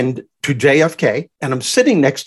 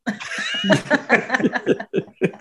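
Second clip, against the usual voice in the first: real laugh; 9 semitones higher, 4 dB quieter than usual. Real, not acted. Laughs at the host's teasing.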